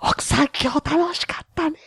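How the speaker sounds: high-pitched imitation of a chatty lady; chatting housewife. Housewife to her friend after an outing, high mock-chatty voice. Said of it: "今ぐらいのトーンでできるじゃないですか".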